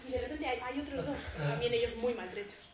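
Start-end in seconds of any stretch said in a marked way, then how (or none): none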